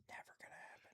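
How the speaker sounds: whispered sceptical aside. Real, not acted. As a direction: whisper it under your breath.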